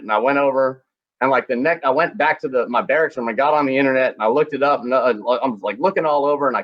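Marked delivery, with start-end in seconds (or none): none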